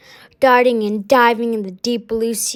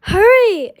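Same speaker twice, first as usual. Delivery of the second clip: high, loud urgent shout; 9 semitones higher, 5 dB louder than usual. A narrator's reading. Rainbow Fish shouting the school toward the shark, loud. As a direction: yell at them to hurry. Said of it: "shouted Rainbow Fish".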